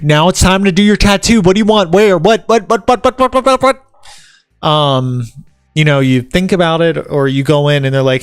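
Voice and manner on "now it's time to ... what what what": hammed-up pestering, ending in rapid-fire squawked words; mock pushy badgering. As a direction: climb higher and higher into frantic repetition. Acting out pushy tattooist, escalating.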